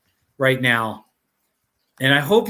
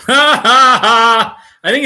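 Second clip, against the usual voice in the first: laughing; 6 semitones higher, 9 dB louder than usual. Real, not acted. Laughing while finding a clip.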